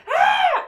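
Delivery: very high loud short scream; hammed-up horror-movie scream; mock scream. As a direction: shriek like terrified kids. Kids scream at the witch.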